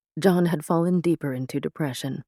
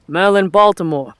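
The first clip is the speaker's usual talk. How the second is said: loud, higher Boston foreman's call; thick Boston accent; 4 semitones higher, 10 dB louder than usual. A narrator's reading. Foreman hails the Merlin at a dig site, thick Boston accent. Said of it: "in a thick Boston accent".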